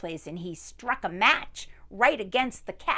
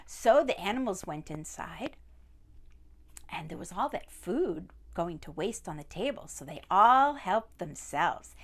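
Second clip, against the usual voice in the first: plain reading voice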